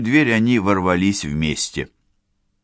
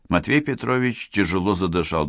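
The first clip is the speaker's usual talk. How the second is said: plain reading voice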